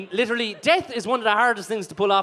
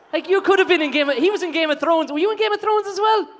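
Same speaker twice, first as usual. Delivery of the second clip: ordinary talk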